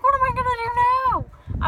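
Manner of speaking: high, loud panic; frantic and lost in the store; panicked. Retold or imitated. Clerk gone, she panics.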